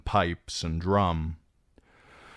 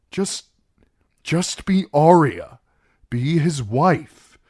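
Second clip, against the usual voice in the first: higher, loud and strained; desperate loud plea; 9 semitones higher, 9 dB louder than usual. Acted. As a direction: beg her, nearly breaking. Theon begs her desperately.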